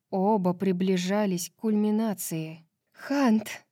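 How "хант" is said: higher, restrained cry of a name; mild cry of passion. A narrator's reading. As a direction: call the lover's name out, pitched up. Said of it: "выкрикнула она".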